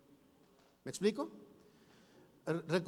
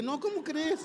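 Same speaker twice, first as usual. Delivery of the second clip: much higher and louder, feigned outrage; mock indignant outcry; 13 semitones higher, 5 dB louder than usual. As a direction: burst out in disbelief. Pastor retells scolding husband.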